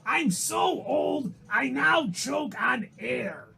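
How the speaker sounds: put-on high, slow old-man voice. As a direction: creak it out like an ancient man. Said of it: "in this voice".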